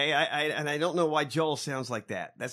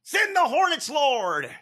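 mock preacher cry; 9 semitones higher, 4 dB louder than usual. Mocking preacher's prayer again.

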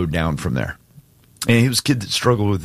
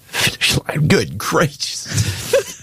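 higher, very slow, voice breaking with emotion; choked up; 10 semitones higher, slower than usual. Real, not acted. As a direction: push the words out through tears. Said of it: "choked you up this morning".

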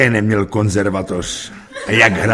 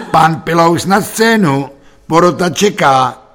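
high, loud repeated summons; calling out; 7 semitones higher, 6 dB louder than usual. A narrator's reading. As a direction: summon him loudly to the stage. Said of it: "V tom zaznělo volání".

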